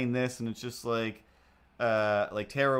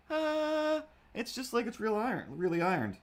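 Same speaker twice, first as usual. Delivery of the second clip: higher, sing-song tone; playful high voice; 11 semitones higher than usual. Pretend. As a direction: lift it up playfully.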